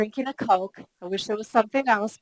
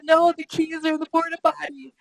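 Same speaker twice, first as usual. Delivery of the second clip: retold tearful wail; 7 semitones higher than usual. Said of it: "I'm bawling crocodile tears".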